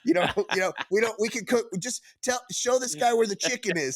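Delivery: laughing commenter. Retold or imitated. Retold commenters teasing the chef, laughing. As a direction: crack up while teasing. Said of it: "they'll be like".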